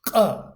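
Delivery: "Q" as a hard stop at the back of the throat; Georgian Q. Shown as a demonstration. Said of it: "more on the back of the throat".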